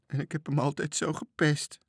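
high, mildly tearful voice; sobbing remorse. A narrator's reading. Marinka regrets teasing the lost Toontje, high tearful voice. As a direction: let regret lift the voice toward a sob. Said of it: "snikte ze".

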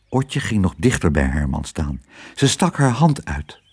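ordinary delivery, nothing marked

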